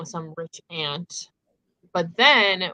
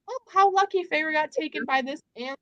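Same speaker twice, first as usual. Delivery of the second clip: much higher, airy sing-song mockery of the family; mock oblivious family; 10 semitones higher than usual. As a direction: parody the family, breezily clueless. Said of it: "they're just like".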